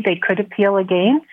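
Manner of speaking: ordinary delivery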